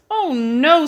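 high, fed-up bear voice; a tired bear at the end of his rope; Mister Bear groaning at the noise; weary Mister Bear. Acted. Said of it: "said Mister Bear".

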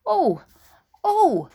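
high, loud joyful "Oh, oh!"; a high, loud burst of joy at a gift; delighted cry. Acted. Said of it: "she jumped up and cried".

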